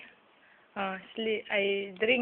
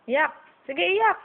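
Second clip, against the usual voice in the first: higher, louder coaxing to cry; playful egging on; 7 semitones higher, 6 dB louder, slower than usual. Real, not acted. (malakas at mataas na panunukso)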